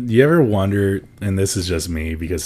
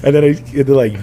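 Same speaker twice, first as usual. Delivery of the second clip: ordinary delivery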